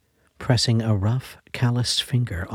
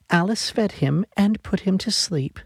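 put-on eager little girl; 8 semitones higher than usual. A narrator's reading. Little girl jumping on her sick mother's bed, high eager voice. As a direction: bounce in like an excited young daughter.

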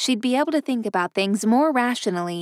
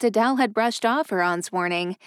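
usual speaking voice

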